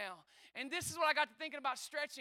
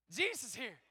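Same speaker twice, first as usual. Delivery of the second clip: ordinary talk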